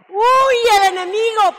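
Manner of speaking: high, loud, slow "Huye el enemigo" with a sob in the voice; tearful preaching cry; fervent preaching cry. Acted. Preaching climax.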